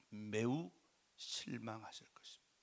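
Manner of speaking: very soft and low, fading into a whisper; hushed disappointment. Real, not acted. Preacher telling how disappointed the Lord would be, hushed. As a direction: drop to a low near-whisper.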